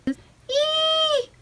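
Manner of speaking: thin far-off squeal, very high; distant high scream. Acted. Captured voice on the ship, faraway high scream. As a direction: let out a faint faraway shriek. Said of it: "It was a scream".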